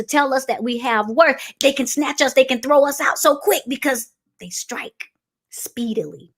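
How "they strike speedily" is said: sudden whisper. Acted. Preacher warning the church about vipers in a whisper. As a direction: drop to an unvoiced whisper.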